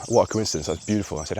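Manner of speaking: plain reading voice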